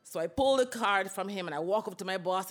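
ordinary delivery

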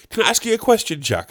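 high-pitched imitation of a chatty labourer; nosy gardener. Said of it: "He would say".

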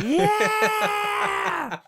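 very high, loud cheering yell; rally shout. Retold or imitated. Rally leader's closing cheer, a loud high yell. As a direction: roar it to the bleachers.

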